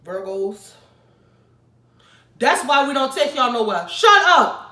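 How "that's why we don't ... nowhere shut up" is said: higher and much louder; exasperated yell. Acted. Telling Virgos why nobody takes them out, a yell. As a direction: let them have it.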